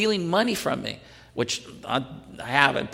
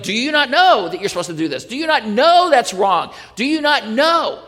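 louder, higher rebuke building to "Do you not know?"; scolding challenge; 7 semitones higher, 4 dB louder than usual. Pretend. Preacher scolding the church in a mock rebuke. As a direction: scold them like a disappointed teacher. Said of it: "it's like".

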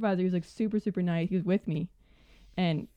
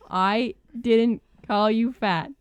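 slow, louder, "I DIDN'T call you fat" spaced out; insistent denial; 3 semitones higher, 5 dB louder, much slower than usual. Retold or imitated. Employee refusing to apologise to the guest, insistent denial. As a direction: retell it word by word, pressing on "didn't".

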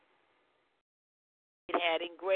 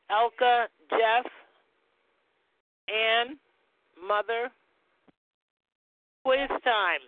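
quiz host; 4 semitones higher, 5 dB louder than usual. Acted. Quiz time with the class.

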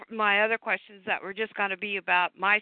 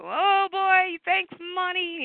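bright, high, joking thanks; playful high excitement; 9 semitones higher, slower than usual. Real, not acted. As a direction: toss it off playfully.

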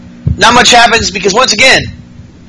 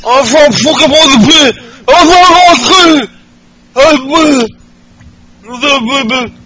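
much higher, garbled mock accent; botched Becky Lynch accent; 9 semitones higher than usual. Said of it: "how to do her fucking accent".

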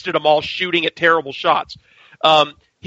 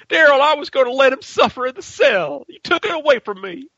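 imitating Rick, pitched up and aggrieved; mock Rick; 9 semitones higher than usual. Rick scolding Daryl for killing Negan, in a raised mock voice. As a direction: play Rick, wounded that his revenge was taken.

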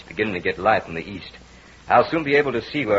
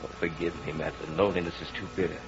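hushed plea; 7 dB quieter than usual. Acted. Softening after outburst.

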